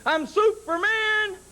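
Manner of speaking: put-on high, slow proud kid cry; little brother's boast. Brother retelling his little brother's rooftop boast, high and slow. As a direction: crow it out like a kid hero. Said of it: "He says".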